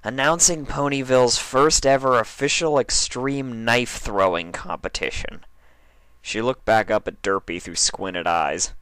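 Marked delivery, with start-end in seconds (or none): 0.0-5.5 lightly higher pony reading a flyer; a hint of Dash reading; Dash reading aloud. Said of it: "She read aloud".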